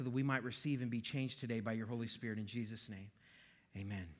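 hushed pastoral prayer, fading to a low amen. Real, not acted. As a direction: pray gently, letting the voice drop away. Leads congregation in prayer.

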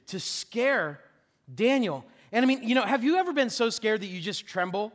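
ordinary talk, nothing marked

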